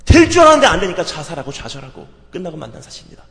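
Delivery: loud, high, urgent sermon voice; full-force preacher's cry; preaching at full force. Acted. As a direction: drive it home to the congregation.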